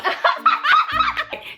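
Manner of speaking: high laugh. Real, not acted. Laughing at own story.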